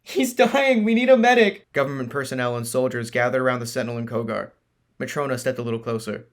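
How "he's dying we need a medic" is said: high panicked yell; desperate shout. Acted. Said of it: "he cried".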